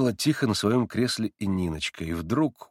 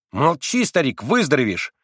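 put-on louder gruff boyish outburst; mock angry shout; 5 semitones higher, 5 dB louder than usual. A narrator's reading. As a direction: bark to hide tears. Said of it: "точно осердившись, крикнул вдруг Красоткин". (будто сердито)

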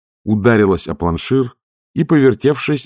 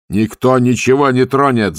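higher, firm shouted reply; shouting back; 6 semitones higher, 4 dB louder than usual. A narrator's reading. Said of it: "закричал Ваймс в ответ". (Ваймс кричит в ответ шкиперу)

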